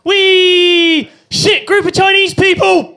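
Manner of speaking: high, loud squeal turning to alarm; gleeful then panicked yell. Retold or imitated. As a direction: shriek in crash panic. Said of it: "in my case".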